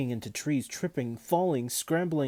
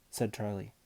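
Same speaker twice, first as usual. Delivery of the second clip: ordinary delivery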